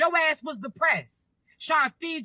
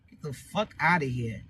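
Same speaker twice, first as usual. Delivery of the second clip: much lower, disgusted muttered grumble; fed-up inner voice; 12 semitones lower, slower than usual. Retold or imitated. Imagining a freeloader walking in, low disgusted grumble. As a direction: growl it low like you can't stand them. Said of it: "you just mad. Like".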